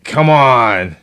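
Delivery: loud, high villain's exhortation; Emperor Palpatine. Retold or imitated. Host imitating a film villain working his power glove, loud villain voice. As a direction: push it out like a gloating evil emperor. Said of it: "he's like Emperor Palpatine".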